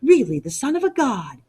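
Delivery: lightly mocked old hags gossiping; a hint of a crone's gossip, hushed at the start; gossiping crones. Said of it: "all the crones and hags get together and whisper".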